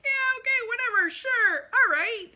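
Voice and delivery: high, loud, breezy brush-off; dismissive owner. Retold or imitated. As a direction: toss it off like a boss who doesn't care. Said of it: "the owner of the boat's like".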